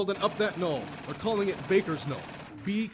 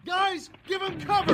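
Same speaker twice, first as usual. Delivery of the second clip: barked order; 10 semitones higher than usual. Acted. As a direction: yell the order over the gunfire. Sergeant ordering covering fire.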